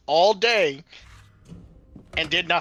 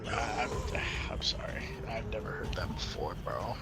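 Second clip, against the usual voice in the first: scared whisper; 7 semitones lower, 12 dB quieter than usual. Real, not acted. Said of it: "I got scared".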